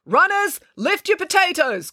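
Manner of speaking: high, drawn-out marshal's call. Acted. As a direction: ring it out over the starting line. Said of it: "called the vice principal".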